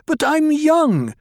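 high, loud, stamping protest; angry protest. A narrator's reading. Harah protesting angrily to Paul, high and loud. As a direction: wail it high and loud, slowly. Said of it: "stamped her foot, shook her shoulders with anger".

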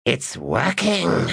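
very slow, high, sly whisper-aside. Acted. Magus gloating privately, very slow and sly. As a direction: gloat to yourself, drawn out.

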